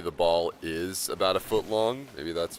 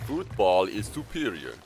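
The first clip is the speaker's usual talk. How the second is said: mock pompous British; 2 semitones higher than usual. Mock proclamation in odd voice.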